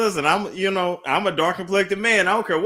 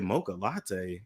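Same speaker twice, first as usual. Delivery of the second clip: slow, softer puzzled repeat of the words; puzzled echo; 8 semitones lower, 10 dB quieter, slower than usual. Retold or imitated. Son echoing mom's odd nickname, slow and puzzled. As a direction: drag it out like you can't place the name. Confused reply to mom's question.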